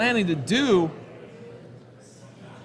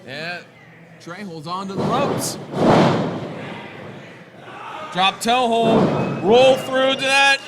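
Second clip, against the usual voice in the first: wrestling commentator; 2 semitones higher than usual. Real, not acted. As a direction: call every move as it lands. Live play-by-play of wrestling match.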